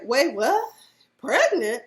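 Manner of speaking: loud shocked question. Retold or imitated. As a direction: imitate Jeff's disbelief. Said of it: "Jeff was like".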